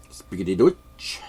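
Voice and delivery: movie German accent, hammed up; mock German accent. Recalling a Bogart movie German, mock German accent. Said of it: "Humphrey Bogart movie".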